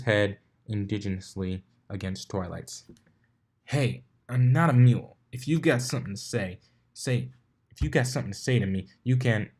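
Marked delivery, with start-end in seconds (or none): 3.6-9.5 cocky stallion pushing back, louder; brash confrontation. Acted. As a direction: get in her face, indignant. Blitz confronts Twilight, louder.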